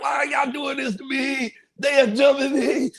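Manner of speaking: high, slow pleading yell; panicked shout. Retold or imitated. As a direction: cry out in disbelief. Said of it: "I'm like".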